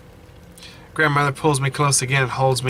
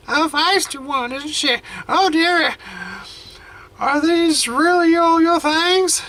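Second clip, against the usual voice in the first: put-on high, slow old granny voice; a frail old grandmother, tender and teary; grandma voice; 13 semitones higher, slower than usual. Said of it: "I lost the grandma voice".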